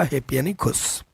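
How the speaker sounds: ordinary delivery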